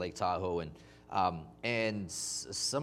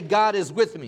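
call and response prompt; 2 semitones higher, 8 dB louder than usual. Shown as a demonstration. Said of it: "Someone say".

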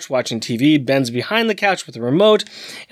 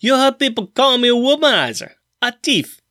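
bad Cajun accent; 2 semitones higher than usual. Podcaster reading a thief's comic-book confession, bad Cajun accent. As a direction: attempt a Cajun accent, badly. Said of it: "That is my worst Cajun accent ever".

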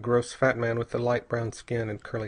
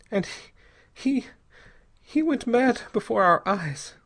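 high, anguished woman recounting horror; hopeless captive woman's higher voice; despairing young wife; 8 semitones higher than usual. A narrator's reading. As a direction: recount it haltingly, pitched high. Narrator voices the girl's account.

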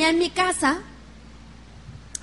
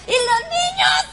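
high-pitched mock shout of alarm; husband's shocked shout. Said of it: "él me dice". (esposo gritando alarmado, muy agudo)

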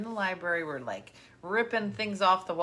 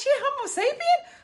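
shocked grandma; 6 dB louder than usual. Retold or imitated. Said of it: "She goes".

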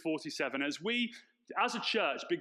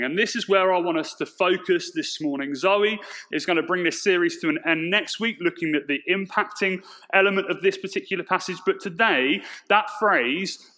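preaching; 10 dB louder than usual. Acted. Sermon at full force.

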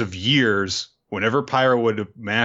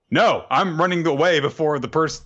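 higher-pitched mock protest; retold alarm; 6 semitones higher than usual. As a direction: cry out in mild, acted alarm. Acting out a fleeing character.

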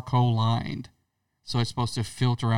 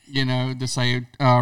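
normal talking voice

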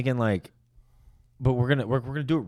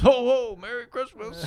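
high, hammed-up jolly Santa ho ho; mock Santa greeting, high and jolly; Santa Claus; 14 semitones higher, 5 dB louder than usual. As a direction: play a booming jolly Santa. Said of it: "Santa Claus goes".

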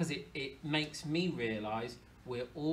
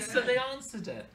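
ordinary talk, nothing marked

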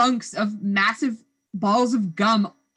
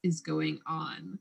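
lower, softer, slow scowling mutter; imitating a scowl; 7 semitones lower, 8 dB quieter, slower than usual. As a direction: grumble it like a suspicious, scowling friend. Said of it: "like, scowling".

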